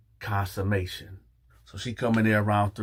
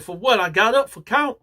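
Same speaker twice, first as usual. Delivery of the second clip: high, raised-voice objection; indignant pitched-up outburst; retold loud protest; 12 semitones higher, 7 dB louder than usual. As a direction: protest loudly in disbelief. Protesting the lockdown to guards.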